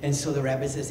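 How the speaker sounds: normal talking voice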